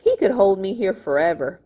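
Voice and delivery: plain reading voice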